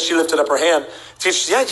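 normal talking voice

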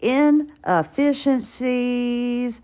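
drawn out slowly while writing it down; slow dictation. Shown as a demonstration. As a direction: stretch "Inefficiencies" out syllable by syllable, as if noting it down. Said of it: "let me write that down".

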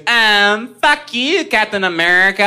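high, loud mock yell at a stranger; comic impression of a furious stranger yelling; mock yelling lady. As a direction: squawk it up high like the angry woman. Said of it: "she yells at me".

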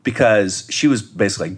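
usual speaking voice

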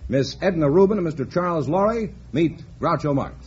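ordinary talk, nothing marked

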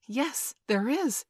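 loud, drawn-out exclamation swooping high; eager cry. A narrator's reading. Peter answers the boys' despair with a way out, crying out. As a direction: cry it out with sudden conviction. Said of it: "cried Peter".

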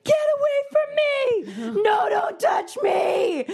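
put-on high, slow panicked child's shout; terrified child screaming. Mother voicing her son's night-terror screams.

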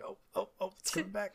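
high, soft little exclamations; mock dawning realization. Fan reliving a memory coming back, high and soft. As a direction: let recognition creep in, high and small. Acts out own dawning recognition.

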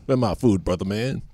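a hint of a cool dog; Rocco voice. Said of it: "Rocco's a soul brother".

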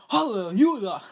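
joyful church-style shout; hallelujah shout. Pretend. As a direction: belt it out like a gospel call. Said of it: "somebody give me a hallelujah".

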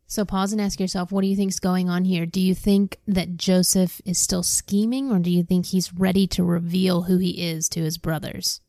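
ordinary delivery, nothing marked

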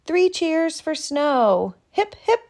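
high, loud cheer. Acted. Narrator leading the cheer, high and loud. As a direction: cheer for the snow.

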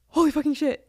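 high-pitched retold shock after a gasp; freaking out. Friend recalling seeing the proposal happen, freaking out. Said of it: "freaking out".

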